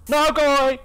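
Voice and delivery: high, loud scared yell; panicked shout. Real, not acted. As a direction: yell at it to back off.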